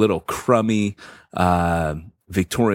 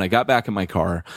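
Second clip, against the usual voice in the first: ordinary talk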